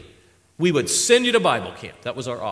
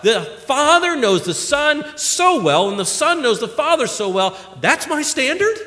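louder, higher preaching push; rising pulpit fervor; fervent preaching; 10 semitones higher, 9 dB louder than usual. Acted. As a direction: preach it out to the congregation. Preaching at full force.

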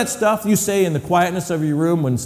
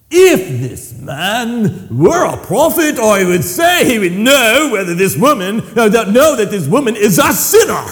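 slow, louder mock British-Italian accent; half British half Italian accent; 5 semitones higher, 6 dB louder than usual. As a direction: play Simon in a hammed-up British-Italian accent. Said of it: "my half British and Italian imitation".

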